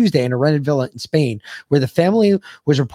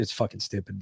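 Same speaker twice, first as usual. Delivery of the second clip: usual speaking voice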